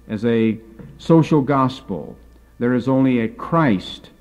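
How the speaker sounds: usual speaking voice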